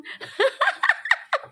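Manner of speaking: high shrieking laugh; a burst of squealing laughter. Real, not acted. Laughing at vendor mix-up. (tawa melengking)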